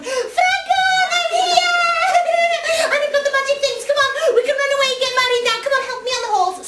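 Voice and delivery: high, loud breathless call; girl yelling to her lover, giddy and loud; excited yell. Acted. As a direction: shout to him across the hill, laughing. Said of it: "And she yelled".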